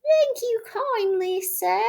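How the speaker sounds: put-on high, slow, frail old washerwoman; feeble voice. A narrator's reading. Toad as washerwoman thanking the gentleman, feebly. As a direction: sound weak and pitiful. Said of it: "said Toad in a feeble voice".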